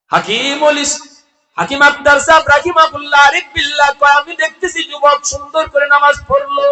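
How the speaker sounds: high, loud sermon declamation; impassioned high-pitched preacher's chant; full-force preaching. Acted. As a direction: ring it out to a big congregation, stretching the last word.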